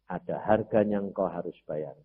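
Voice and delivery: usual speaking voice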